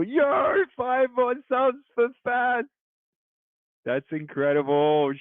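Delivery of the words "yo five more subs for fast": high, slow hype call; bright streamer hype, pitched way up; excited shout-out. Real, not acted. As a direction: cheer the news to chat.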